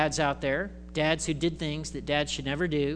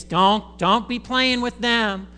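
louder, slow, lightly put-on scolding; stern parent warning; 5 semitones higher, 6 dB louder, slower than usual. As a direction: warn the kids off the neighbours. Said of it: "You would tell your kids".

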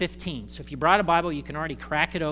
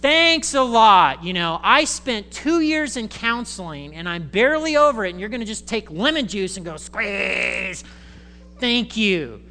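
sarcastic thanks; 3 semitones higher, 7 dB louder than usual. Retold or imitated. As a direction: sneer the gratitude back at the church. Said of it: "you're like".

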